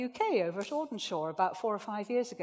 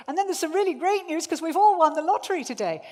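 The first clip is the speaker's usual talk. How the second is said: higher and louder, thrilled; great news excitement; 7 semitones higher, 8 dB louder than usual. Shown as a demonstration. Speaker to audience, showing thrilled great-news delivery. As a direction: sell it like a lottery win. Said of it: "how much more interesting it is when we change the pace and we change the tone".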